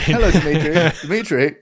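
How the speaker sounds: hammed-up pleading hello to an old friend; imitating Sellers' Dimitri. Fan quoting the President's hotline call for laughs, hammed-up greeting. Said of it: "Just the way he says Dimitri".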